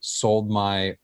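normal talking voice

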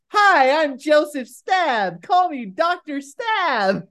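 high, slow, sing-song voice; hammed-up high professor voice; imitating the professor. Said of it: "And you get".

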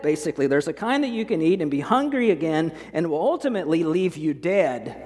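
ordinary delivery

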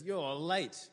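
loud, high-pitched acted accusation; playing a character's loud complaint; calling out in reproach. Acts Frodo's reproach to Gandalf.

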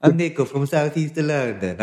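put-on light, lifted Italian-accent introduction; mock Italian accent. Podcaster teasing a guest he took for Italian, mock Italian accent. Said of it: "I did think you were Italian".

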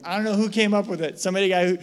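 ordinary delivery

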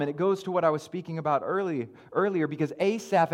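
normal talking voice

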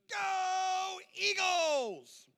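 put-on high, long drawn-out cheer of a small boy; little boy cheering. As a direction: stretch out "Go Eagles!" high like an excited kid. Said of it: "and he goes".

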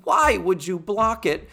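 high, slow, emphatic frustration; exasperated. Real, not acted. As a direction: spell out your disbelief, word by word. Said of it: "that was so frustrating".

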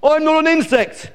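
very high, loud indignant squawk; outraged outburst. Acted. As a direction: squawk like an insulted eagle. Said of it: "squawked Glen".

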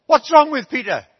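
loud, sharp question, pitch up; mother snaps at the lad about her son; alarmed mother. Retold or imitated. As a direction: demand it in alarm. Mother at door hears news.

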